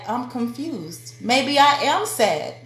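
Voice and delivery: a mock voice of lost confidence; confused self-doubt. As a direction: wonder aloud like someone talked into doubting herself. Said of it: "you're gonna look back and be like".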